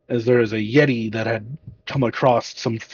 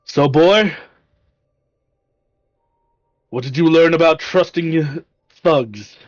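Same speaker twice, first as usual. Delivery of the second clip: a lightly voiced dwarf, raised and louder; gruff dwarf; 4 semitones higher, 3 dB louder than usual. GM voicing the dwarf to the young human, lightly gruff. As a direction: play the old dwarf chiding the boy.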